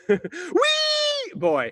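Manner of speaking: put-on high, loud squeal of Wee boy; squealed name. Naming the squid for the crowd with a gleeful squeal. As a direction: shriek it out with delight.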